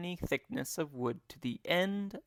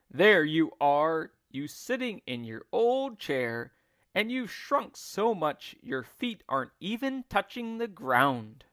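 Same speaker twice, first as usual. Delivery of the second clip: shouted; 4 semitones higher, 6 dB louder than usual. A narrator's reading. Husband shouting at his seated wife, louder. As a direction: bellow it while pointing. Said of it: "pointed at her and shouted".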